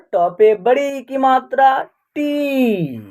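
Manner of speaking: "टी" held and falling; pronunciation demo. Shown as a demonstration. Teacher showing how the letter is pronounced, "टी" drawn out. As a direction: spell it out slowly, ending on a long "टी". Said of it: "इसका उच्चारण होगा".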